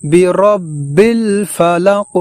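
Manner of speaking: কুল আউযু chanted slow and drawn; melodic, unhurried tilawat; slow Quran recitation. Shown as a demonstration. Teacher models correct recitation of the verse, slow chant. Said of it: "এই শব্দটি আমরা এইভাবে পড়ব".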